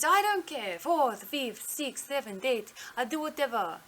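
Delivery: put-on dismissive doctor in a rough Indian accent; attempted Indian accent. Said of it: "she's in her accent".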